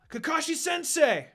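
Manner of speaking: high, loud cry of alarm; alarmed yell. A narrator's reading. Students yelling for their collapsing teacher, alarmed high shout. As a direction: shout his name as he collapses. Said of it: "they yelled".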